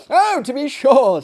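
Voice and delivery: high, loud quick agreement; eager assent. Acted. Narrator eagerly agreeing with the host, high and loud. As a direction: chime in eagerly. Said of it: "said I".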